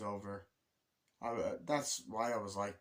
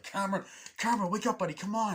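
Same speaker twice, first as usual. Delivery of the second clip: high, loud and fast pleading; frantic, louder rescue plea; urgent calling; 11 semitones higher, 6 dB louder, faster than usual. Retold or imitated. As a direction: yell to wake a friend up. Pulling Cameron out, reviving him.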